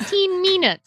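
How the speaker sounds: put-on "teen" with a long stretched E; Kiwi vowel. Shown as a demonstration. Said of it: "The trick is to extend your E".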